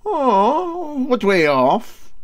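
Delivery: high, falsetto-ish feigned surprise, slow at the end; mock innocent query. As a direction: play dumb in a high voice. Para Handy feigning ignorance.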